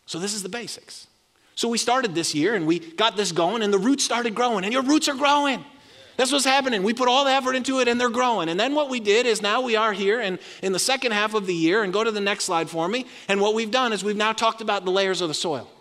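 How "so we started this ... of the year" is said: rising, louder preacher's push; preaching at full force. Acted. Pastor rallying the congregation, full-force preaching. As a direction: stir the church as the roots grow.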